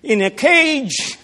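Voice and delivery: mocking enemy commander. Commander taunting the besieged king, mocking and loud. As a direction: sneer it like a gloating conqueror. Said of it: "he's mocking and he's saying".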